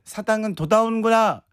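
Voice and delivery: put-on 사당은 said with a childlike lisp for 사랑은; 사당은 돌아오는 거야, r turned to d, tongue short. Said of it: "이랬던 것같은데".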